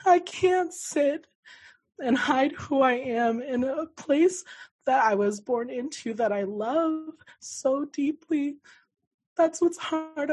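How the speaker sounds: high, strained plea with drawn-out words; halting, anguished and pitched well up; retold tearful outburst. Telling her sister she can't go back, tearful anguish. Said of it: "I bawled my eyes out".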